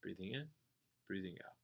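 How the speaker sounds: quiet, calm return to the breath; soft breathing cue. Shown as a demonstration. Teacher returning to meditation after the outburst, soft. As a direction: settle back down softly. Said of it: "Oh, yeah".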